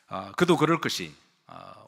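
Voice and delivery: ordinary talk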